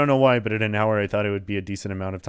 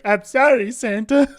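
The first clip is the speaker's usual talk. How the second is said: high, louder panicked sorry; frightened kid apology; 12 semitones higher, 7 dB louder than usual. Retold or imitated. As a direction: beg Santa in fear. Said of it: "you're like".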